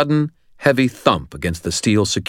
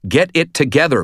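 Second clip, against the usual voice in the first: tense, raised scolding; strained, forceful reprimand; tight voice; 10 semitones higher, 5 dB louder than usual. A narrator's reading. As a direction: say it tightly, urging him to pull together. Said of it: "I said, my voice tight".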